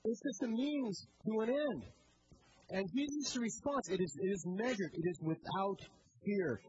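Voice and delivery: usual speaking voice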